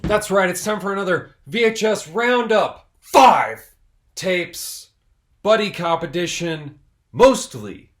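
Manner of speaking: loud, high announcer hype; hyped show intro. Acted. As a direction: blast the intro like a host.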